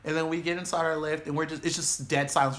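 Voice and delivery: ordinary delivery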